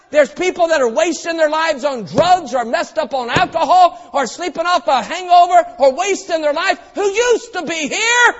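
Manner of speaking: ordinary talk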